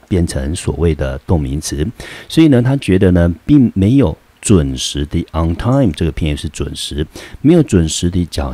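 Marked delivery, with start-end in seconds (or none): none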